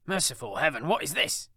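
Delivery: in terror. A narrator's reading. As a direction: recoil in horror as you say it. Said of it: "He recoiled in terror".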